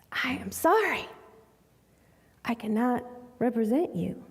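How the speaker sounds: whispered, soft final refusal; almost whisper the apology; hushed refusal. Retold or imitated. Lawyer whispering he cannot take the case.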